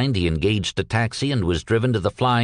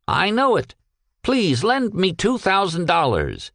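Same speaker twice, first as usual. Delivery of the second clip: pleading; 9 semitones higher than usual. Acted. Peck begging the captain for two thousand dollars, pleading. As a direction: beg earnestly for a favour.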